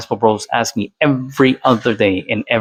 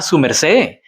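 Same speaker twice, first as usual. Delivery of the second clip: put-on su merced in Boyacá Colombian Spanish, rising on su; Boyacá way of speaking. Shown as a demonstration. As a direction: say su merced the way Boyacá locals do, lifting su. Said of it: "That's how they talk".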